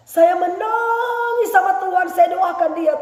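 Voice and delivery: high, loud, slow sung-out preaching; preaching at full force. Acted. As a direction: wail it out to the church. Preacher cries out.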